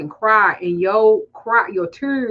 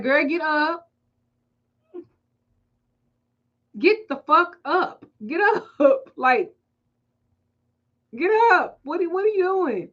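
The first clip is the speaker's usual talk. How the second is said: higher, pleading with the fan to stand; imitating herself begging a kneeling fan; mock embarrassed plea; 5 semitones higher than usual. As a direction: urge them up, flustered. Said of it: "I'm like".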